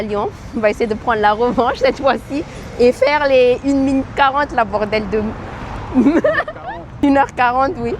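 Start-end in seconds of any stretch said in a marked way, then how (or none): 5.3-7.0 high, sudden laugh at a slip; high burst of laughter. Real, not acted. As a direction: break into a high giggle.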